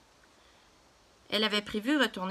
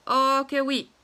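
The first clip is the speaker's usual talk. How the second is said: louder insistence; 4 semitones higher, 6 dB louder, slower than usual. Acted. Cashier and papa bicker.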